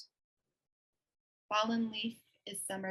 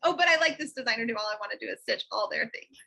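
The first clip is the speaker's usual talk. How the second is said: gushing excitement; 7 dB louder, faster than usual. Retold or imitated. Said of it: "I'm like".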